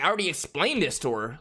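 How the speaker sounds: ordinary delivery, nothing marked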